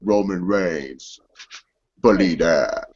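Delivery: low, slow growl of a wrestler's catchphrase; deep slow Roman Reigns catchphrase; Roman Reigns impression. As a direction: drop low and drag the catchphrase. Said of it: "that's my Roman Reigns impression".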